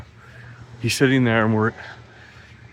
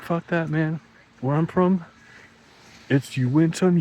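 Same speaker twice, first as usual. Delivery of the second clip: cocky comeback; 7 semitones higher than usual. Retold or imitated. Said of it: "I'd be like".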